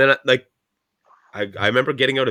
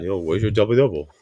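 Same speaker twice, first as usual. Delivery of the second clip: dad voice; 5 semitones lower than usual. Retold or imitated. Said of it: "Why does he sound like G-Rock".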